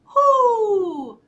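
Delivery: high, loud, long hoot; one big falling "hoo"; owl hoot. A narrator's reading. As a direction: call out like a startled owl. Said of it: "Owl called".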